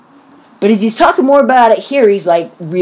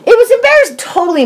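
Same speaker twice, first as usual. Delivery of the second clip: emphatic; 13 semitones higher, 3 dB louder, faster than usual. Real, not acted. Agreeing emphatically, reliving embarrassment.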